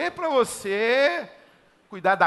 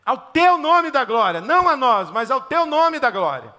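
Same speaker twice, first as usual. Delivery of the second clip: psalmist's proclamation; 3 semitones higher, 3 dB louder than usual. Acted. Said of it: "Aí vem o salmista e diz assim". (proclamação fervorosa de salmista)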